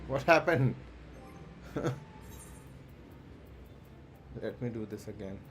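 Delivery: ordinary delivery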